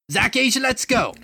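coach calling in. Acted. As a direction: call him off the bench.

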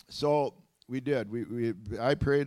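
usual speaking voice

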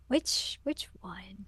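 hushed, unvoiced aside; whispered question. Jocken asks about the boy, whispering. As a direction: whisper it as an imitated character's query. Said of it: "Jocken was like".

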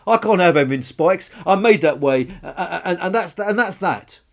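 loud, stammering huffy insistence; defensive outburst. Acted. Dinosaur defends her spikes to brontosaurus, huffy and loud. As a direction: stumble loudly towards a final word.

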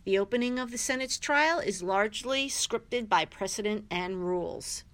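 usual speaking voice

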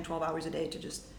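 normal talking voice

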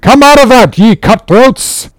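high, loud menacing order; captain's roar. A narrator's reading. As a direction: roar at the mutineers to come out. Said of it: "roared the captain".

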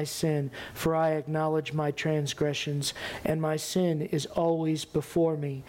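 low, subdued psalm of repentance; sober, even-toned prayer of confession; solemn scripture reading. Acted. Reads David's psalm of repentance.

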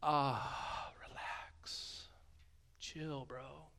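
soft, whispered chill-bro relax; a soft, breezy mock of the misread verse; mock laid-back. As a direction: brush it off like a lazy surfer. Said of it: "that it's like a".

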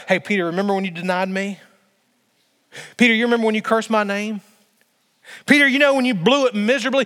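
a reproachful voice that builds; building mock accusation. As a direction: press Peter harder with each question. Preacher voices Jesus confronting Peter.

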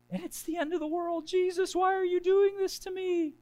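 high, melodramatic fake wail; mock despair. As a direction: whine it as if a tiny hurt were a catastrophe. Mock agony over a paper cut.

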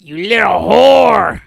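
furious mom. Retold or imitated. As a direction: spit it at your daughter with contempt. Whoopi as mom insulting daughter.

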